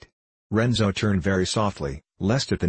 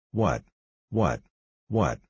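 stammered; slower than usual. A narrator's reading. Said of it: "stammered Don Abbondio".

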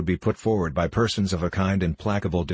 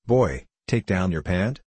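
usual speaking voice

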